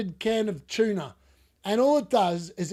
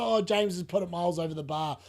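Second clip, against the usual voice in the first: ordinary delivery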